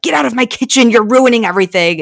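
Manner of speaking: high, loud, quick mock outburst; angry kitchen outburst. Cook throwing someone out of the kitchen, mock angry. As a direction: act out an exasperated order.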